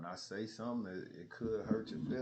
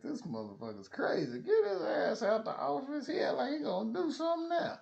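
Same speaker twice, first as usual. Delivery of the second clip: shrill high mock panic, getting louder; mocking the bosses; 6 dB louder than usual. Imagined managers panicking about him, high mock squeal. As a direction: play the scared managers, squealing. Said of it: "It's like".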